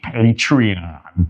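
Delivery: Patreon slow, in a put-on American accent with a broad a at the end. Shown as a demonstration. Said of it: "And in American English".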